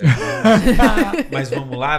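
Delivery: loud laugh bursting through the words. Real, not acted. Laughing loudly while teasing his friend on the podcast. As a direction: cracks up mid-joke about his friend. Joking that Manaus gave him a friend.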